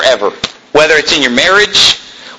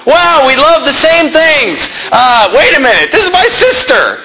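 mock gushing excitement; 11 semitones higher than usual. Preacher mocks a dater gushing, then realizing it's his sister, high excited shout. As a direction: gush over every match, then wail at the twist.

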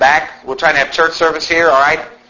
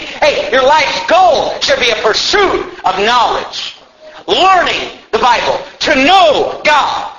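preaching at full force; 6 semitones higher, slower than usual. Acted. Pastor urging the church to learn the Bible, full-force preaching. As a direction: drive each command home to the pews. Preaching climax, high and loud.

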